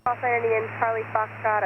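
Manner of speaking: ordinary talk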